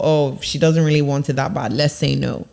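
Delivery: low, offhand dismissal; dismissive boss. Acted. Employers deciding about her request, low and dismissive. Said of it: "gives them the option to think".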